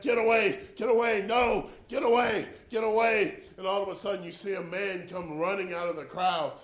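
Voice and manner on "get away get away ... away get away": loud, higher yell waving people off; injured runner yelling at the doctors; shouting get away. Retold or imitated. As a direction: push the doctors off with your voice. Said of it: "So he kept".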